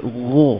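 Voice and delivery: full "gha" rounded toward "gho"; correct heavy GHA. Shown as a demonstration. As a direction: raise the back of the tongue on "gha".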